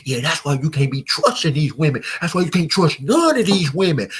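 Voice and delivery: loud, high, worked-up rant; mock heated outburst, pitch climbing; getting all heated. Man on a date ranting about women, heated. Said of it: "you start getting all heated".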